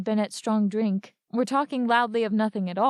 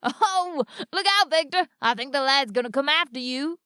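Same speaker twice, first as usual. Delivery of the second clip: mocking bystander; 5 semitones higher than usual. A narrator's reading. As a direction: tease Victor like a crowd heckler. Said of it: "said someone in the crowd".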